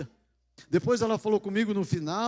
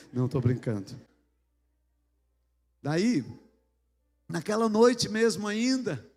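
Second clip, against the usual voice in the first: ordinary talk, nothing marked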